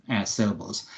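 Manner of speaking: normal talking voice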